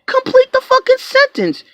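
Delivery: snapping at the buyer to finish her sentence; exasperated yell. Retold or imitated. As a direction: yell it high, fed up. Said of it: "I'm like".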